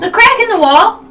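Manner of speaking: high, loud insect voice; chirpy bug correcting, pitched high; beetle voice. Acted. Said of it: "You hear in beetle speak".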